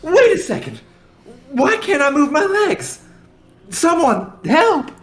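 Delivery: high, loud panicked pleading; panicked cry. Acted. Character panics, can't move.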